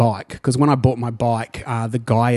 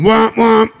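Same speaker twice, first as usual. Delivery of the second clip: horn imitation; 9 semitones higher, 5 dB louder than usual.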